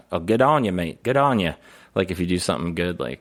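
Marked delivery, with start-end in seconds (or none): 0.1-1.5 higher mock-Australian praise; lively imitation Australian accent; Aussie phrase. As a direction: congratulate a mate in put-on Aussie. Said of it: "They say".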